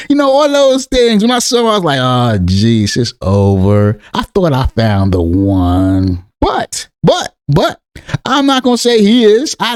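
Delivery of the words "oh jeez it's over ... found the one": low, slow, crestfallen drawl; mock-mournful sigh of defeat; acted disappointment. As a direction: play up the heartbreak, dragging it out low. Acts out his disappointed first reaction.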